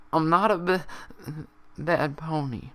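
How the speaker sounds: high, faltering stammer; tearful repeat. Acted. Pinkie echoing Applejack, tearful and halting. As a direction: push through the tears.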